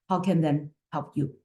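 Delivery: ordinary talk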